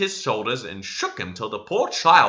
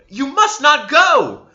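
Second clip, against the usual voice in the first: high, loud and slow plea; energetic exclamation; 9 dB louder, slower than usual. A narrator's reading. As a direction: forbid him with full force. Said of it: "she exclaimed energetically".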